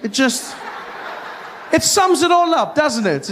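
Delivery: normal talking voice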